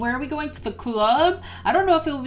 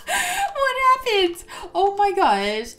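high, drawn-out, tearful with laughter; real helpless, high-pitched bafflement; laughing wail; 9 semitones higher, slower than usual. Real, not acted. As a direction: squeak it out slowly in disbelief. Child glitched across map.

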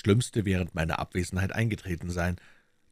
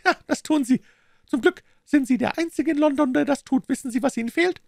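much higher, louder voice; mocking, pitched-up villain; sneering villain Smith; 6 dB louder, faster than usual. A narrator's reading. Smith answers the sick Holmes, mocking high voice.

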